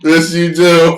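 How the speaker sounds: high, loud put-on gloat; Ashley Miller voice. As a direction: keep taunting in her voice. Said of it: "Ashley Miller talks like".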